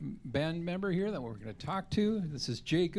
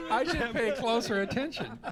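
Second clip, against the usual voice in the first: real laugh while talking, higher and louder; 9 semitones higher, 5 dB louder than usual. Real, not acted. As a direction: laugh through a self-mocking admission. Host laughs at own missed joke.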